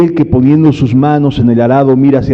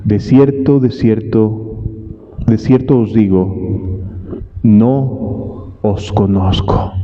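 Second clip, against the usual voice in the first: low, slow and softer verdict; stern Lord's answer; 5 semitones lower, 3 dB quieter, much slower than usual. Retold or imitated. Preacher voicing the Lord refusing the latecomers, low and slow. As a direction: pronounce it slowly and gravely, like a final judgement. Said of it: "Mas él respondiendo dijo".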